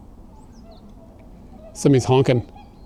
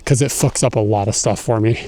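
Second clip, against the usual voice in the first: usual speaking voice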